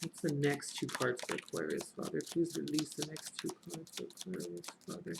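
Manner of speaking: hushed, whispered plea to Father; whispered prayer. Real, not acted. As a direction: murmur it like a private prayer. Asks spirit for next cards.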